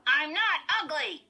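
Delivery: squeaky, high, loud dummy retort; Slappy. A narrator's reading. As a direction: protest in a puppet's squeak. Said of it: "said in Lindy's squeaky voice".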